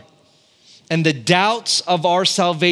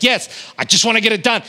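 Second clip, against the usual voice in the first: higher, louder eager insistence; insistent yes; 7 semitones higher, 3 dB louder than usual. Retold or imitated. Husband insisting to wife he must finish the sink, higher and louder. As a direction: push back, keen to finish.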